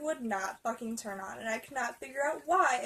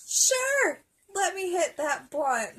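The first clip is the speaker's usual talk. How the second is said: high, loud, drawn-out acceptance; hammed-up gung-ho agreement; mock eagerness; 7 semitones higher, 7 dB louder, slower than usual. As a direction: sing out an over-keen yes. Acts out own foolishly eager acceptance.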